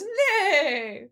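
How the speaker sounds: high, loud mock whinny; whinny like a horse, hammed up; horse neigh. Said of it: "She turns into a horse and goes".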